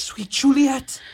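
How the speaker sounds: loud, high, drawn-out call; a lover's slow yearning cry. Acted. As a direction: call to her across the dark. Romeo calling out to her.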